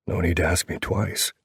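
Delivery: ordinary delivery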